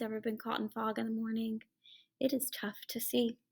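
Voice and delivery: soft unvoiced whisper; whispered aside. Real, not acted. Reader to children about fog, a hushed whisper. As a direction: lean in and whisper it.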